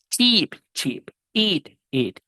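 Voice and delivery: first of each long ee, second quick ih; long i versus short i. Shown as a demonstration. Teacher giving words to practise, long versus short i. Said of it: "yo quiero que usted practique".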